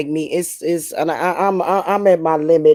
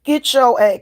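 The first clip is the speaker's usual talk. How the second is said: mock telling off; 2 semitones lower than usual. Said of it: "I'm be like".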